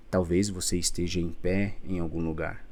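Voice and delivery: ordinary talk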